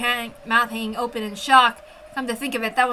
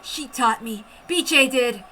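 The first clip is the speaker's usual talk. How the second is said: usual speaking voice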